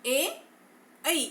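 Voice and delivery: ए, ऐ sounded clearly; vowel demo. Shown as a demonstration. Teacher demonstrates ए, ऐ for students to copy. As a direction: glide ए into ऐ. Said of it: "इसका प्रयोग उच्चारण करके आप भी देखिएगा".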